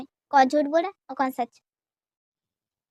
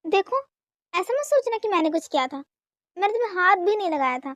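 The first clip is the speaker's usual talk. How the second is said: normal talking voice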